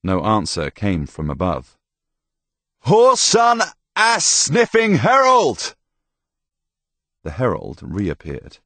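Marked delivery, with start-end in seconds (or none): 2.9-5.8 slow, high, loud bellowed insult; angry shout. A narrator's reading. Tomas insults the unseen herald, shouting angrily. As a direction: hurl each word up the wall.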